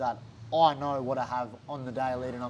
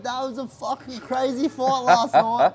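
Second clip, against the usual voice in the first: high, louder fan-like gushing; pumped-up doctor; 9 semitones higher, 6 dB louder than usual. Retold or imitated. UFC doctor raving to fighter about last night, excited.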